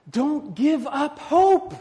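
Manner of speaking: high, slow hopeful plea; Isabel's voice. Retold or imitated. Isabel voiced in retold dialogue.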